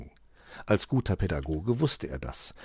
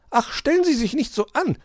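very high, loud dismissive chide; impatient magpie; 8 dB louder than usual. A narrator's reading. Frau Elster tells the fox not to fuss, high. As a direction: brush off his fuss. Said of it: "sagte die Elster".

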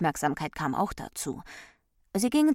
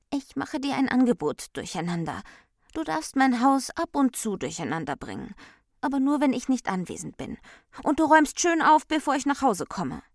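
high voice, growing louder and quicker; prim, firm Ordnung; 8 semitones higher, 5 dB louder than usual. A narrator's reading. Ordnung offers Durcheinander a deal, prim and insistent. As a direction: set conditions in a high, insistent voice.

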